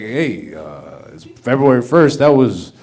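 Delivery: low, mumbling country DJ; yokel radio DJ. Retold or imitated. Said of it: "It's like".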